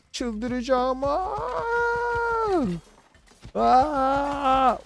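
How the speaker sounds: excited squeal. Real, not acted. Gets unlimited ammo, freaks out.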